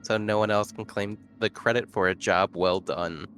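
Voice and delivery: usual speaking voice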